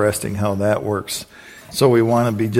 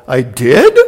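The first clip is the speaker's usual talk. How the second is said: surprised; 8 dB louder than usual. Retold or imitated. Surprised reply in story.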